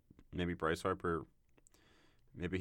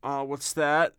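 mock deafness; 7 semitones higher, 7 dB louder, slower than usual. Feigning not hearing.

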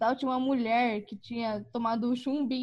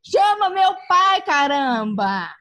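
loud, slow, drawn-out demand; crying shout; 6 semitones higher, 9 dB louder, much slower than usual. Retold or imitated. Bleeding girl ordering her cousin to call dad, loud crying shout. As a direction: bark the order at him, desperate. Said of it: "Aí eu toda chorando".

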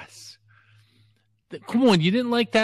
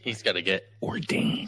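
hushed conspiratorial whisper; 5 semitones lower, 7 dB quieter than usual. Real, not acted. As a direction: whisper it behind a hand. Joking aside about ordination.

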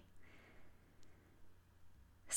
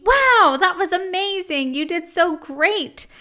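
gushing praise; 6 semitones higher, 4 dB louder than usual. Retold or imitated.

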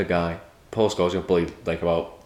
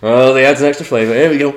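higher, louder relish; Paul Scholes impression; 5 semitones higher, 9 dB louder than usual. As Paul Scholes about to tuck into his daughter's toenails, high loud mock relish. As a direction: savour it playfully, pitched up and loud. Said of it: "great Paul Scholes impression".

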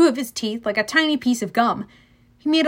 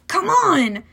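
higher, urgent call; urging; 7 semitones higher, 4 dB louder than usual. A narrator's reading. Grover tugs Percy's shirt, urging him. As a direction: hurry a friend along.

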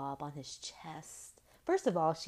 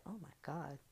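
softer, breathy stunned aside; hushed oh my God; 2 semitones lower, 11 dB quieter than usual. Retold or imitated. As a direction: mutter it, stunned.

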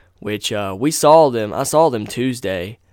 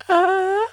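sudden high-pitched moaning cry; mock moan, very high; imitated high moan. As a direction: yelp it out like the moan on the recording.

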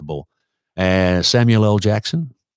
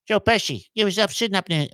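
much higher, lightly mimicked voice; put-on character voice; 11 semitones higher than usual. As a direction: break into a high impression. Acts out a film-star impression.

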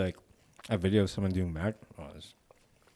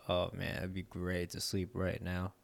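softer, drowsy musing; sleepy; 2 semitones lower, 8 dB quieter than usual. Acted. Friend describing a nice afternoon's drowsiness, softly sleepy. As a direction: voice a mild longing for a nap. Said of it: "when you're really sleepy".

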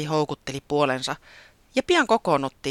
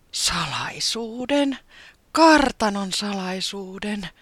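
slow, high, relishing each word; savouring greedily; 7 semitones higher, 5 dB louder, much slower than usual. A narrator's reading. Taputi savouring the ghost's secret, slow and greedy. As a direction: taste the words hungrily. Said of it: "maisteli sanoja ahnaasti".

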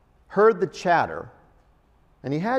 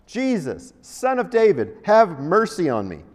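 shouted plea; 2 semitones higher, 5 dB louder than usual. Retold or imitated. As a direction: call out over the crowd. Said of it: "he shouted".

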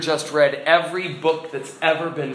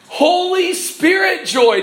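high, slow, ringing delight; soaring pulpit joy; exultant proclamation; 12 semitones higher, 4 dB louder, slower than usual. Real, not acted. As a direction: draw it out jubilantly. Preacher exults over the joy.

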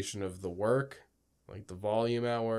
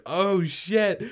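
high, loud alarmed cry; mock-panicked shout of shock; panicked oh shit; 10 semitones higher, 10 dB louder, much slower than usual. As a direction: blurt it out in sudden panic. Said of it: "I was like".

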